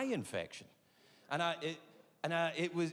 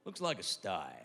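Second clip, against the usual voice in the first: deep doctor voice; 8 semitones lower than usual. Retold or imitated. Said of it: "He went".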